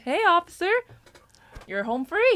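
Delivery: lightly voiced partner waving off the officer.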